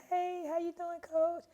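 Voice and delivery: high, loud, sing-song innocence; mock innocent greeting. Player greeting her coach at the door, faking innocence. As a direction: play it sweet and innocent to dodge trouble.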